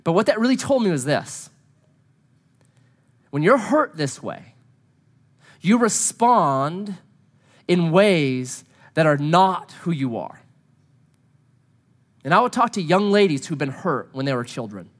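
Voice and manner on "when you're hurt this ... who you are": slow deliberate emphasis. Acted. Lean on it, unhurried and deliberate. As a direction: stretch every phrase out, very slow. Sermon point, drawn out.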